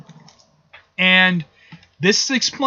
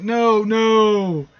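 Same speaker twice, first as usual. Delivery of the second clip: drawn-out, higher cry of mock dismay; anguished Magneto; 4 semitones higher than usual. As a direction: wail it lightly, stretching each no. Magneto's distressed cry, acted.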